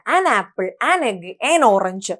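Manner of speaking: an egg, an orange said slowly and clearly; slow model phrases. Shown as a demonstration. Teacher gives slow examples of an before a vowel. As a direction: spell them out slowly for the class. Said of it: "ആൻ കൊടുക്കുക".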